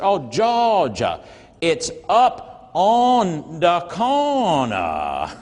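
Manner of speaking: "Oh, Georgia, it's up on the corner" dragged out very slowly. Retold or imitated. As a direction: spell it out slowly as if to a foreigner. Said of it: "Like somehow he needed to say it slower".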